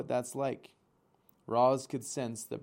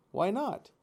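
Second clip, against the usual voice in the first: put-on pleading young gosling; 10 semitones higher, 4 dB louder than usual. A narrator's reading.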